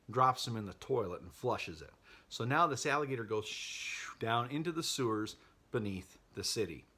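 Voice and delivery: ordinary talk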